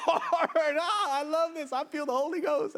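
high-pitched, elated outburst; exuberant delight. Real, not acted. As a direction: exclaim as if swept up in the spirit. Preacher revels mid-sermon in the moment.

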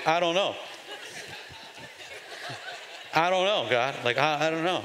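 a hint of a sulky, doubting shrug; mildly sass God back, unconvinced; with attitude. The pastor mimics a believer mildly sassing God with attitude. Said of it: "you even do it with attitude".

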